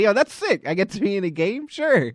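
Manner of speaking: high, loud eager yes; hyped-up player saying yes; acted excitement. Imagines being asked into game.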